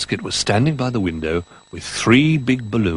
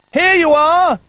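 much higher, louder shout; 9 dB louder, slower than usual. Acted. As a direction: Bramwell shouting down to Jolly. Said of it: "he shouted".

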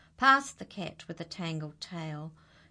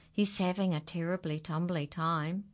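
ordinary delivery

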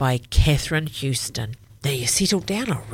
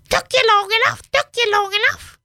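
very high, loud squawking parrot; 6 dB louder than usual. Acted. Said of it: "squawks the parrot".

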